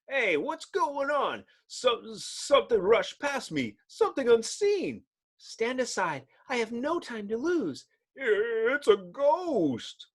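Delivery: high frightened voice; jumpy bystander; spooked passerby. Acted. Man brushed by something unseen, high and scared.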